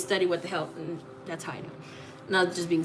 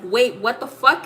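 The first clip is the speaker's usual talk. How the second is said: louder, higher mock-stunned outburst; retold shocked disbelief; 4 semitones higher, 7 dB louder than usual. Retelling her shock at being right, stunned disbelief.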